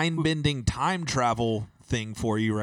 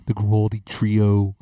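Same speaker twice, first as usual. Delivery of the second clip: public radio host; 3 semitones lower, 6 dB louder than usual. Retold or imitated. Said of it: "public radio voice talking".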